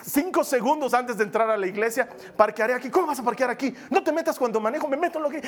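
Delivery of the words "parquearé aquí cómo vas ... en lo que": high, testy back-and-forth, hammed up; bickering couple. Couple fighting seconds before entering church, irritated. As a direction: act out a petty squabble over driving. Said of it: "nos peleamos".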